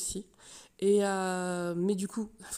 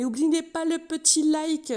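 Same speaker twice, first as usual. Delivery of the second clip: higher, louder, slow sing-song; sing-song plug; 9 semitones higher, 10 dB louder, slower than usual. Real, not acted. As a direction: stretch it out like a cheery plea. Outro call to like.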